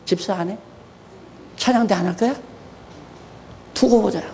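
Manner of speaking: hushed mock threat; quiet menace. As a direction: murmur the warning darkly. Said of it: "요나의 표적 얘기하면서".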